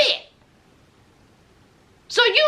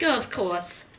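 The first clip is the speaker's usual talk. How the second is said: low, quiet sarcastic mother; sarcastic mama; 10 semitones lower, 7 dB quieter than usual. Retold or imitated. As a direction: drop it low and dry, like an unimpressed mom. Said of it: "the mama was like".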